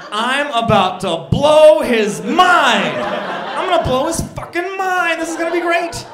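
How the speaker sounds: high, loud, slow and giddy; gleeful excitement. Retold or imitated. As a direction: shout it like a grand scheme is coming. Excited inner thought, big plan.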